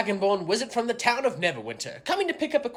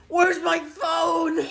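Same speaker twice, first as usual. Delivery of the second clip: put-on frantic drunk yelling; panicked shout; 8 semitones higher, slower than usual. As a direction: yell it in a panic. Drunk panics over lost phone.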